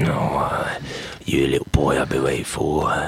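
low, breathy creep voice, barely voiced; creepy pervert. Creepy old farmer greets young man in a low breathy voice. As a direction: leer at the boy in a low creepy rasp.

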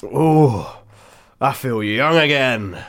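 lightly voiced rejuvenated man; loud, slow, reborn old man; young man voice. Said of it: "he said".

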